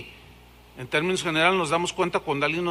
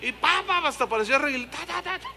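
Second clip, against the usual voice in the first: slapping tongues babble; 6 semitones higher, 4 dB quieter, faster than usual. Retold or imitated. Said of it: "porque hacía así".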